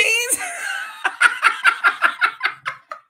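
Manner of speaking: airy high laughter; breathless laugh. Real, not acted. Host laughing at her own classroom story. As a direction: laugh out at the memory. After the punchline.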